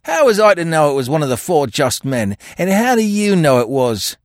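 high, loud retort; cornered crook's challenge; defiant, cunning. A narrator's reading. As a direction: argue back and turn the question round. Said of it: "added with a cunning twist".

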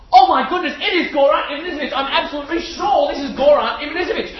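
high, loud fanboy outburst; starstruck excitement. Retold or imitated. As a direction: gush in giddy disbelief at your hero. Retold starstruck outburst at seeing hero.

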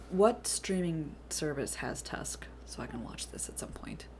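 whisper. Real, not acted. Asking about the film.

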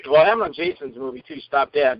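ordinary talk, nothing marked

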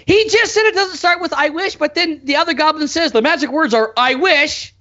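high, rising exasperation; exasperated. Real, not acted. Fan ranting about goblin lines, exasperated and high. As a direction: point out the contradiction, pitch climbing. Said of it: "this is what pisses me off".

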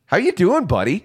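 high, loud, happy hello; excited greeting. Real, not acted. Greeting guest joining the show.

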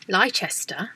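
"Leicester" as lie-CHESS-ter; "Leicester" with a ch in the middle; spelling mispronunciation. Shown as a demonstration. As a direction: misread "Leicester" in three syllables. Misreading a confusing place name.

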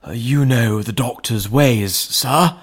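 put-on a frightened old butler; anxious butler. A narrator's reading. Butler Poole in story.

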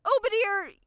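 loud, high calling out a name; dramatic summon call. Acted. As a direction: call the summoned one grandly.

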